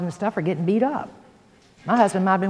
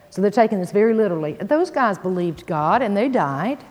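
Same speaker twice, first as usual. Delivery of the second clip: ordinary delivery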